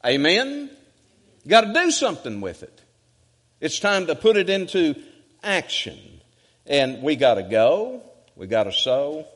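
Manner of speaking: high, loud exhorting preacher; full-force preaching. Real, not acted. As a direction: preach it hard, punching the key words.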